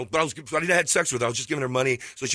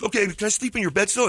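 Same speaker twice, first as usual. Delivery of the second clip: put-on Chilean accent; 6 semitones higher than usual. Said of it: "he goes".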